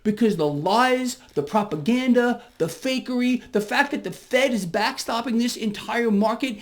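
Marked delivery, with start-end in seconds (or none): none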